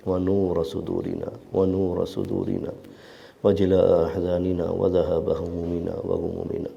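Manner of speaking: slow, steady recited supplication; prayer. Real, not acted. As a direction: recite the dua with devotion. Guest leads closing dua.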